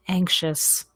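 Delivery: ordinary talk, nothing marked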